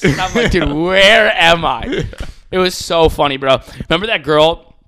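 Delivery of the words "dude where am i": loud, high, drawn-out disbelief; exaggerated baffled outburst; acted bewilderment. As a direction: wail it slowly, totally lost. Acts out his bewildered reaction.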